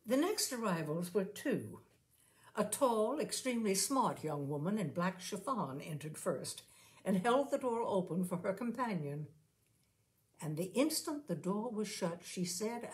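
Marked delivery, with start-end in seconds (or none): none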